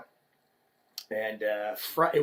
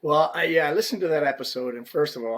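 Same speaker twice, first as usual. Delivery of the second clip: ordinary talk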